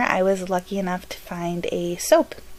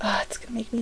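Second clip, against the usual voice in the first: breathy whisper before a sneeze; 4 semitones higher, 9 dB quieter, faster than usual. Real, not acted.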